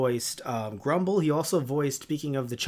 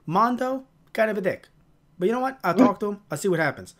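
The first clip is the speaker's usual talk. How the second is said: higher New York grouch impression; brusque, pitched-up New York shrug-it-off talk; Grumble; 5 semitones higher, faster than usual. Grumble grumbling about kids and Mondo, New York grouch. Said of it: "He has this New York accent".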